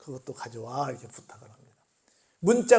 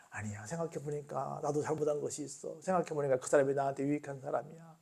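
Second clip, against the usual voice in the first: conciliatory; 3 semitones lower, 9 dB quieter than usual. Retold or imitated. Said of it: "이렇게 해야지".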